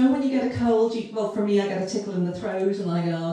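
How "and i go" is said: usual speaking voice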